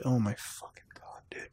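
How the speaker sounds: breathless unvoiced whisper of disbelief; shocked whisper. Real, not acted. Gamer whispering in disbelief at a deep web page. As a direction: whisper it like you can't believe your eyes. Stunned reading conspiracy site.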